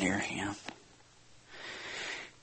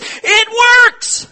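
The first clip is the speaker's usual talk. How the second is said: high, loud excited yell; student calling out to the pastor from across the room; 11 dB louder than usual. Retold or imitated. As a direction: shout the news across the room. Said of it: "He yells across the room".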